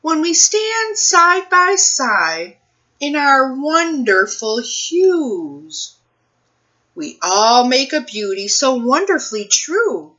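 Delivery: put-on reading to children. Librarian reading a picture book to children, expressive storytime voice. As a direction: read it like storytime for little kids, savoring each rhyme.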